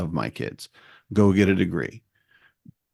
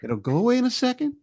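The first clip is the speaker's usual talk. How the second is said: high, hammed-up calming voice; imitating himself soothing them; mock soothing; 12 semitones higher than usual. Repairman calming panicked kid, mock soothing. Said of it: "I'm like".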